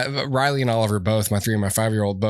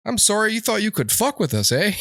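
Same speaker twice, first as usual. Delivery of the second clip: higher, sweetly menacing Canadian; mock Canadian threat; 8 semitones higher than usual. Canada scolding the world in high mock accent. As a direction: apologize while threatening. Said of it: "Canada's like".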